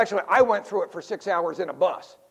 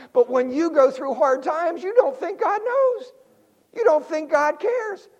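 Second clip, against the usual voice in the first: earnest appeal; 9 semitones higher, 4 dB louder than usual. Acted. Preacher slows for emphasis.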